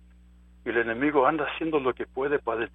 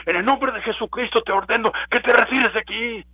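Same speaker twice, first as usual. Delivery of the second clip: rebuking command; 7 semitones higher, 4 dB louder than usual. Retold or imitated.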